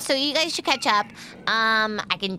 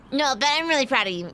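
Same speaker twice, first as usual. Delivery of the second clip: ordinary delivery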